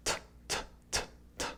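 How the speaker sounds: bare whispered t clicks with no voice; unvoiced t. Shown as a demonstration. Teacher shows the t sound to feel at the throat: soft voiceless taps. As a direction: tap out a breathy t, t, t. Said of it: "Try making a T sound".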